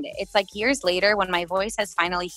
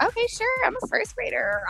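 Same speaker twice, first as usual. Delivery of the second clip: put-on much higher, little-girl voice; childlike self quote. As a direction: play her first-grade self agreeing. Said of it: "And I'm like".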